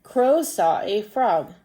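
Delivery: slow, deliberate story reading; children's story read-aloud. Acted. Teacher reading a crow story to pupils, slow storytelling. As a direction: read slowly and clearly for young pupils.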